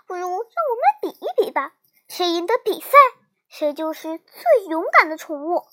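ordinary delivery

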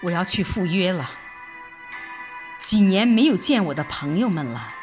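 normal talking voice

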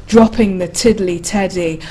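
ordinary delivery, nothing marked

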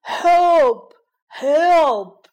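loud, high desperate roar for help; trapped lion roaring for help. A narrator's reading. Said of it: "狮子吼叫道".

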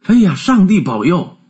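high-pitched cry of surprise; startled exclamation. A narrator's reading. Fred hears his uncle call, startled high exclamation. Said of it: "弗雷德大叫起来".

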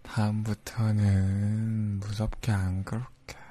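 slow, deliberate, earnest; slow sincere promise. Acted. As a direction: promise it slowly and clearly. Promising, apologizing.

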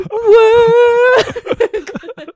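sob. Retold or imitated. Crying at the memory.

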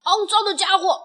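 loud, high, furious threat; angry shout. A narrator's reading. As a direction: yell it like a furious little fighter. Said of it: "愤怒地喊道". (愤怒地大喊)

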